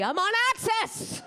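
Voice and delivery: high, exultant yell; mock triumph. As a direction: crow it as a huge career triumph. Mock bragging about minor TV channel.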